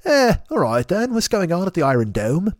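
hammed-up British spy with a stiff upper lip; badly faked British accent; British spy show. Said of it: "sounds like some British spy show".

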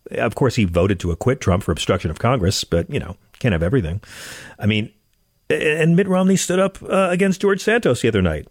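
ordinary talk

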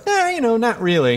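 high breezy brush-off; dismissive shrug. Retold or imitated. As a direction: shrug it off casually. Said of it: "Most of them will be like".

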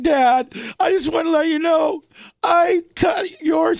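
high, sobbing cry to his dad; crying confession. Retold or imitated. A boy sobbing out his confession to his dad. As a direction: wail the confession through tears. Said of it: "I just started crying right there".

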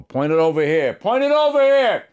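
loud barked order, rising to a high yell; irritated movie star shouting at the cameraman; tough-guy impression, building to a shout; De Niro. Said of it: "I was doing the De Niro voice".

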